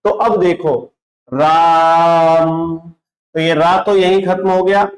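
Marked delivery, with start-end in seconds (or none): 1.3-2.8 राम with the vowel held very long and steady; राम को लंबा खींचो; pluta राम. Shown as a demonstration. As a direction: hold the आ in राम like a chant. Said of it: "इसका जो उच्चारण ... थोड़ा लंबा हो जाता है".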